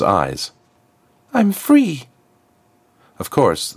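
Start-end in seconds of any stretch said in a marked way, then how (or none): 1.3-2.1 put-on much higher, wondering girl's voice; awed freed girl. Freed girl realises she is free, high and awed. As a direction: breathe the words in disbelief.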